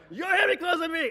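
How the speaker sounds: high, loud, furious yell; screaming. Retold or imitated. Father screaming back at Uncle Frank, furious. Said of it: "they start screaming at each other".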